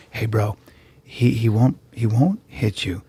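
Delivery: low, soft menacing whisper in the ear; whispered threat. Pretend. Friend whispering threats into the bully's ear, low soft whisper. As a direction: lean in and breathe it into his ear. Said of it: "I'd go".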